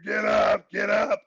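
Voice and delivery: loud imitated coach shout; repeated yelling. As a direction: holler it from the sideline. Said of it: "I just kept hearing".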